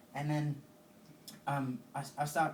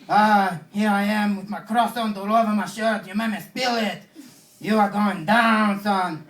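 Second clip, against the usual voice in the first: loud, high, hammed-up French accent; mock French accent; 7 semitones higher, 15 dB louder, slower than usual. French guy over his spilled croissant, shouting in mock French accent. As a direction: bellow it in a comic French accent. Mocking a French policeman's yelling.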